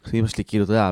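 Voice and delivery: ordinary talk, nothing marked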